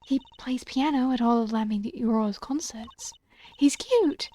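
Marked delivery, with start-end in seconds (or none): 3.6-4.3 higher, louder squeal; gushing. Acted. Fan gushing about the pianist, high squeal. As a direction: blurt it out delighted.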